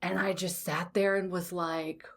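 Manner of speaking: ordinary talk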